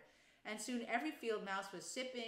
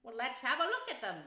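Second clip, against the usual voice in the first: louder, higher call from the rat; keen, raised storybook voice; eager cry; 4 semitones higher, 7 dB louder than usual. A narrator's reading. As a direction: cry out with interest. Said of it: "cried the rat".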